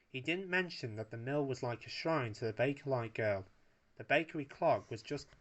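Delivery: ordinary delivery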